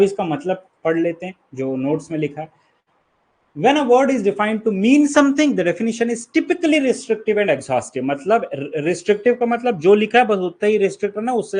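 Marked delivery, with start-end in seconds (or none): 3.6-8.0 a higher, slow, careful textbook read; slow formal reading. Acted. Teacher to students, reading the notes out slowly. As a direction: dictate the definition word by word.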